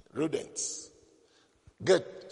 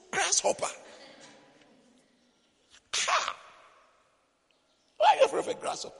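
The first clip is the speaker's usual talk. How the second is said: incredulous; faster than usual. Retold or imitated. Mock disbelief at daughters' fear.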